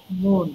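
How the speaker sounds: মন said with a short "mon" vowel; teacher demonstrating the proper মন; correct pronunciation. Shown as a demonstration. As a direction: model মন clearly as "mon". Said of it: "কিন্তু শুদ্ধ উচ্চারণ".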